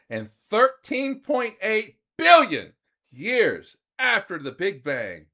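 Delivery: dramatic narration. Acted. Dramatic cosmic narration build-up.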